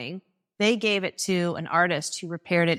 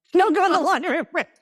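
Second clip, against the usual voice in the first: calling out; 11 semitones higher, 6 dB louder, faster than usual. Retold or imitated. Mother calling warning to daughter.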